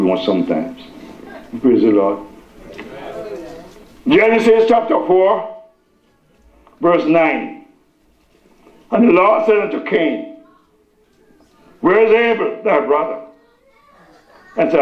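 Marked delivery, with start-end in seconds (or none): 4.1-14.8 high, projected scripture reading. Real, not acted. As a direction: announce the verse from the pulpit. Reads scripture to congregation.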